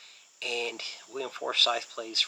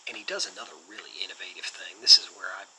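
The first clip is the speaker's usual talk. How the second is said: ordinary talk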